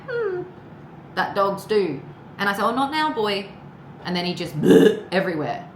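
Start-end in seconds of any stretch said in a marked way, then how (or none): 0.1-0.4 very high whining hum like a dog; dog whine imitation. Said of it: "He's, he's doing that". 4.6-5.1 short loud burst of laughter. Real, not acted. Laughs while retelling dog vomiting.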